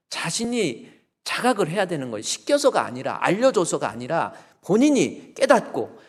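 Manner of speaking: normal talking voice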